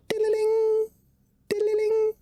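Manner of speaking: high tinkling bell sound; bell jingle. Acted. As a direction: chime it like a little bell.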